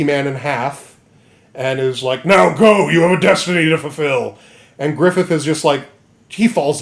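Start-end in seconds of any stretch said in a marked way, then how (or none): 2.2-4.4 loud, put-on demon voice; monster's command. Zod sending him to his destiny, in a loud monster voice. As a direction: proclaim it as a hammed-up monster.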